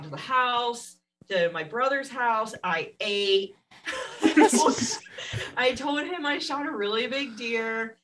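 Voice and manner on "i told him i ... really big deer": higher, giggling through the line; laughing. Real, not acted. Telling the host what she told him, giggling. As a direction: laugh it out as you confess. Laughing at her own story.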